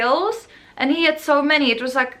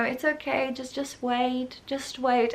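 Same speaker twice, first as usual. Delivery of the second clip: much softer, slow condescending hush; patronizing soothing nurse; 6 dB quieter than usual. Retold or imitated. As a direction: brush him off with a soft pat-on-the-head tone. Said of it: "the attitude of the nurses".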